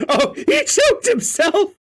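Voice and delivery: squealing through laughter; very high, helpless laughing exclamation. Real, not acted. Reader seeing that he choked himself, shrieking with laughter.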